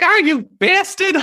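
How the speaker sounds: high, angry New York puppet voice; furious little cartoon New Yorker; Rage Rabbit. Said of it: "he's got this high, angry sort of New Yorkie".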